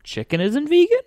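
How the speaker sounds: very high, loud squeaked question; hammed-up disbelief leaping into falsetto; shocked disbelief. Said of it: "And the guy's like".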